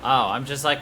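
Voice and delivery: usual speaking voice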